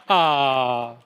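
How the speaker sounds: long, falling sentimental "aah"; cooing "aah". Shown as a demonstration. Preacher prompts audience at his children's photo, drawn-out cooing "aah". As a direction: draw out an "aah" over a cute picture. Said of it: "You got an ah".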